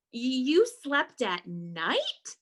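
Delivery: slow, incredulous question; shocked gasp. Acted. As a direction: draw the question out in disbelief. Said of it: "gasped another".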